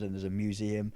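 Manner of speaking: ordinary delivery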